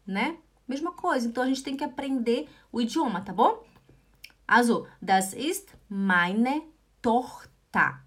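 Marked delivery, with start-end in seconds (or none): 5.0-8.0 "Tochter" like "torta", in slow syllables; slow model pronunciation. Shown as a demonstration. Teacher modelling for learners, slow, "Tochter" ending in "ta". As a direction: say it word by word, "Tochter" as TOCH-ta. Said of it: "É como se fosse a torta de bolo".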